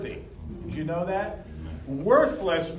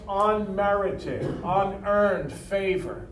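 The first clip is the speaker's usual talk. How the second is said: slow emphasis; much slower than usual. Shown as a demonstration. Defining grace slowly.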